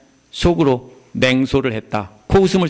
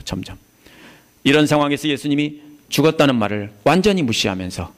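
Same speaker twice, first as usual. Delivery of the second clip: ordinary talk, nothing marked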